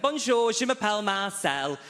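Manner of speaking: eager answer. Retold or imitated. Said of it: "I went".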